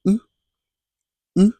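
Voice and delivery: rounded French U sound, lips pushed forward. Shown as a demonstration. Teacher modelling the French U for students.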